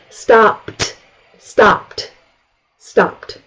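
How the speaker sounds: "Stopped" ending in a crisp T, one syllable; voiceless final T. Shown as a demonstration. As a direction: clip "Stopped" with an unvoiced T. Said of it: "t is voiceless".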